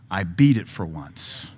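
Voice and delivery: slow, low "I beat it for once"; slow relief. Retold or imitated. Believer relieved after beating temptation, slow and low. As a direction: savor the victory slowly. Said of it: "and I can say".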